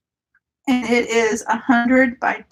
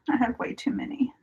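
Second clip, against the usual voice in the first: whispered confession; 2 semitones higher, 8 dB quieter, faster than usual. Real, not acted. Confessing the big stash.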